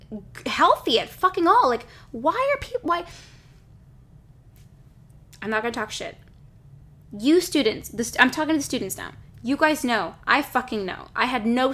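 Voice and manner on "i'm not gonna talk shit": ordinary delivery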